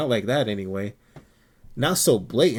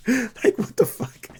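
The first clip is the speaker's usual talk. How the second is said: "what the fuck" drawn out, much higher and slow; stretched disbelief; 11 semitones higher, slower than usual. Real, not acted. Reacting to people insulting a friend's skin tone, drawn out high.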